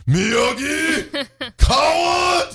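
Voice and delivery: very high hammed-up movie villain yell; mock yell. Customer in throes of joy yelling, hammed-up. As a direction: yell it out in mock ecstasy, voice soaring. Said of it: "He's just gonna yell out".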